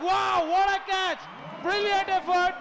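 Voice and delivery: high, drawn-out wow at a catch; excited shout. Real, not acted. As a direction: cry out in amazement at the catch. Stunning catch called live.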